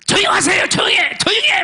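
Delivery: high, urgent retold scolding; angry complaint. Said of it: "와더니 막 문을 막".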